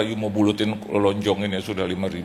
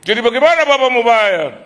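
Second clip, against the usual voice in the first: high, loud, pushy demand; hammed-up collector shouting the question; 13 semitones higher, 10 dB louder than usual. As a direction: press him hard for the money. Debt collector demanding payment.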